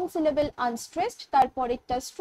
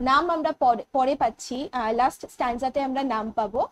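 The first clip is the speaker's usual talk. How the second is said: ordinary talk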